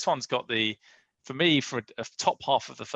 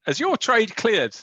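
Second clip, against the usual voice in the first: raised, higher call, drawn out slowly; 12 semitones higher, 8 dB louder, slower than usual. Real, not acted. Host calls to Scott about his pending trade, loud slow call. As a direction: call across to get Scott's attention.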